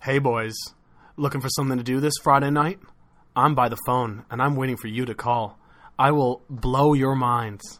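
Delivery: low, mock-seductive come-on. Hotline man inviting male callers, low seductive voice.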